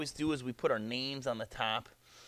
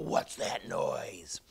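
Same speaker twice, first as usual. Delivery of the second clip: very low, slow, rasping growl; deep, whispery monster voice; growling dragon; 7 semitones lower, much slower than usual. Acted. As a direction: drag it out as a deep beast growl. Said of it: "growled the dragon".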